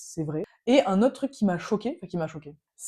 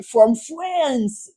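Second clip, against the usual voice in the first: louder, higher self-mocking French-accented English; bad French accent; 5 semitones higher, 6 dB louder than usual. French traveller answers a friendly American, proudly hammed-up French accent. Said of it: "avec mon super accent".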